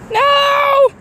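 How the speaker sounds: high loud cry; startled cry. Real, not acted. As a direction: yelp as it flies off. Bird runs away.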